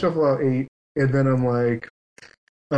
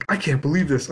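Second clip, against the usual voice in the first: usual speaking voice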